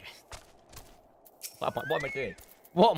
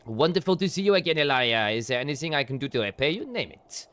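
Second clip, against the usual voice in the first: put-on lightly French-accented lord, courteous; 5 semitones lower than usual. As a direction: greet the hero like a grateful French noble. Same lord NPC again.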